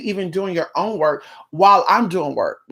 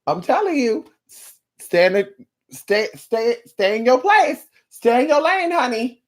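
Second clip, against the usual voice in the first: mock warning; 4 semitones higher than usual. Reader telling client to stay in lane, high mock warning. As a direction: warn them off in a pitched-up voice. Scolds the nagging client, joking.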